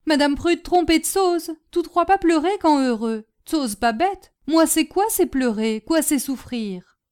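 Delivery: hurt servant who is no fool; loyal, simple servant. Acted. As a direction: protest earnestly, word by word.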